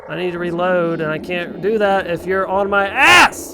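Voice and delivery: loud, high shouting that builds; exasperated yelling. Real, not acted. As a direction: yell at an enemy crowding you. Yelling at enemy crowding player.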